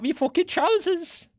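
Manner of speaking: high-pitched caricature British voice; mock British accent. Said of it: "just a British thing to be like".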